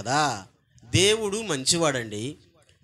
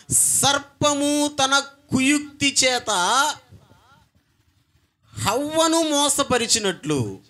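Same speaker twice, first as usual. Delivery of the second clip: slow, higher, ringing verse proclaimed to the church; proclaimed scripture; 6 semitones higher, 3 dB louder than usual. Acted. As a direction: declaim the verse slowly like a pulpit reading.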